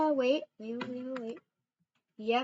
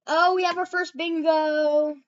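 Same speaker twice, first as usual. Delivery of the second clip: loud, high, drawn-out cheer; hammed-up bingo announcement; 6 semitones higher, 12 dB louder than usual. Shown as a demonstration. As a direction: belt out a slow, over-the-top celebration. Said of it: "That was horrible. Let me try that again".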